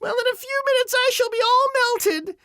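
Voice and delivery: very high wailing witch voice; shrieking witch, building to a long final cry; melting witch. A narrator's reading. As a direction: wail out dying words as a witch. Dying witch's last wailing speech.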